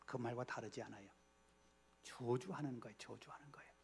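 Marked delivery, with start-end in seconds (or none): 3.0-3.7 hushed whispered repeat. Shown as a demonstration. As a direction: breathe it out as a whisper. Preacher repeats phrase as whisper. (속삭이듯 낮게)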